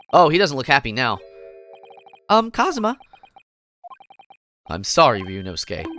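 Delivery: ordinary delivery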